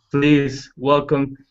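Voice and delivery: ordinary talk, nothing marked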